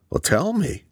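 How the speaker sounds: high, eager demand; impatient driver demanding news. A narrator's reading. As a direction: snap it out hungry for news. Eamon impatient.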